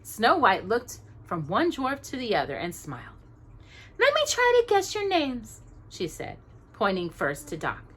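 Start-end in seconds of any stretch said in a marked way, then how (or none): 4.0-5.6 glad princess. A narrator's reading.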